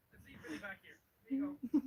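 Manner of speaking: normal talking voice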